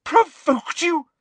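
high, loud, slow incredulous shout; shouted disbelief. A narrator's reading. Professor rejecting pupil's excuse, shouted in outrage. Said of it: "shouted Professor McGonagall".

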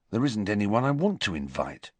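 ordinary delivery

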